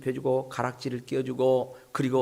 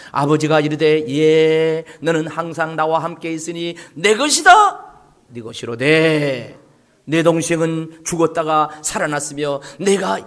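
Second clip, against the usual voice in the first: scripture reading; 10 dB louder than usual. Real, not acted. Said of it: "같이 읽어봅시다".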